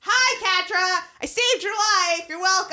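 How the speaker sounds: high, bright, cheery voice; cheerful Entrapta. Retold or imitated. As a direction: chirp it like a proud inventor. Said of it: "Enter Entrapta".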